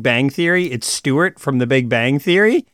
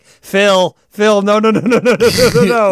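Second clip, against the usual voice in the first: raised, urgent repeated no's; alarmed pleading; 5 semitones higher, 4 dB louder than usual. Retold or imitated. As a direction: Jane begging Phil to stop the fight, alarmed and raised. Jane panics as brawl starts.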